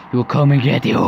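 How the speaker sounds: loud, escalated menace; mounting threat. Acted. False mother threatens the daughter with the monster, loud menace. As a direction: drive the threat up toward a cackle. Threat repeated, building into cackle.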